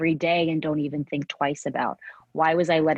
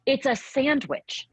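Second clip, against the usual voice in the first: exasperated; 8 semitones higher, slower than usual. Real, not acted. Exasperated at her old self.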